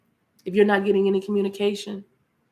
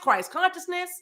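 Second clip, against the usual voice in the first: ordinary talk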